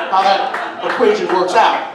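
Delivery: ordinary talk, nothing marked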